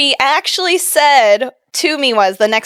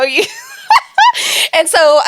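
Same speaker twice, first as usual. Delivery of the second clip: ordinary talk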